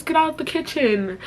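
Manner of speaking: high mock scolding voice; scolding relative. Family scolding her, retold.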